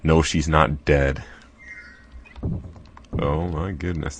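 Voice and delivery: ordinary talk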